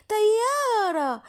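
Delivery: drawn-out amazed cry of plane, pitched high; surprised. Acted. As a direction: stretch the word out slowly in wonder. Character spots the plane.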